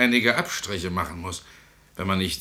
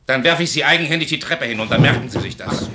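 louder angry threat; 4 semitones higher, 5 dB louder than usual. Acted. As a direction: lean in and warn him, raised voice. Threatening to throw him downstairs.

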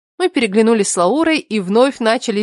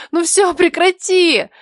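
high, drawn-out begging; slow, high-pitched plea; pleading; 9 semitones higher, slower than usual. A narrator's reading. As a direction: beg your tickling friend to stop, stretching the last word. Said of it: "умоляла её".

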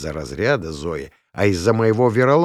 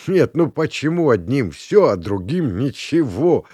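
loud, raised mock complaint; boisterous protest; 5 semitones higher, 3 dB louder than usual. A narrator's reading. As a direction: protest jokingly to the room, stretching the end. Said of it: "захохотал Ромка". (шутливое громкое возмущение)

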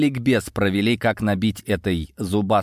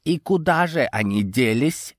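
slightly higher, mocking question; snide; 4 semitones higher than usual. A narrator's reading. Саранча needling Йован about the missing money, snide. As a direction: needle them with a sly question. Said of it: "ехидно спросил Саранча".

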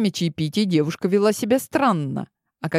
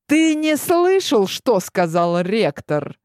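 snapping angrily; 5 semitones higher, slower than usual. Acted. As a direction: voice jumps high, outraged. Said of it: "Сорвался Адриас".

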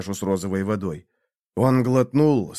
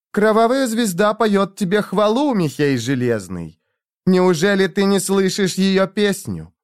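higher, louder rapturous call; ecstatic prophetic cry; 9 semitones higher, 3 dB louder than usual. A narrator's reading. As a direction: proclaim it in rapture. Ashera exalted, star sings.

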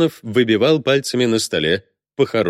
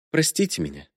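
softly spoken apology; hushed plea for forgiveness; quiet; 6 dB quieter than usual. A narrator's reading. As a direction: let it out under your voice. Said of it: "тихо прозвучало в комнате".

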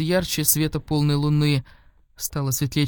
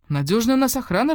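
higher, lilting satisfaction, lightly acted; rejoicing; 7 semitones higher than usual. A narrator's reading. Old man pleased with his guard, rejoicing. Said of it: "радовался он".

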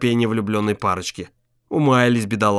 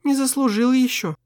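gentle woman's voice; 11 semitones higher than usual. Acted. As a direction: play the tender wife. Voiced wife's lines.

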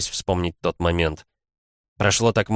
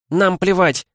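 angry yell; 13 semitones higher, 5 dB louder, slower than usual. A narrator's reading. Villager yelling back.

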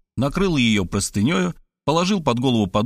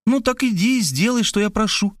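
bright, much higher, pleased voice; pleased Maria; 9 semitones higher than usual. A narrator's reading. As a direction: glad he finally agrees. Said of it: "обрадовалась Мария".

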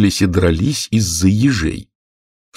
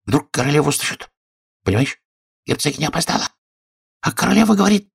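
hushed, higher, anxious confiding; frightened; 7 semitones higher, faster than usual. A narrator's reading. Frightened rabbit confides in Alice, hushed and anxious. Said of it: "испугался кролик".

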